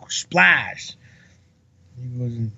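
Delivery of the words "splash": big drawn-out splash sound effect; imitated big splash. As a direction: mimic something heavy hitting the water. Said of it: "you could actually hear it going".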